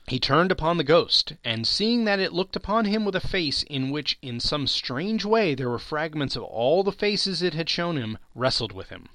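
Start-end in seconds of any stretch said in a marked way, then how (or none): none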